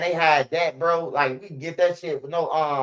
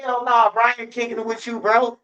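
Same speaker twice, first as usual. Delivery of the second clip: higher, heated mock outrage; indignant refusal; 4 semitones higher than usual. Scolding friend for disrespecting his mama, heated. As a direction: act shocked and scolding. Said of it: "I'm like".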